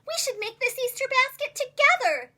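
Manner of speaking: cheerful offer. A narrator's reading. Friendly offer after laughing.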